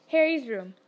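usual speaking voice

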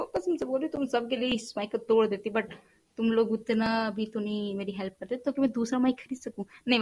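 normal talking voice

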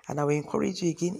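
ordinary delivery

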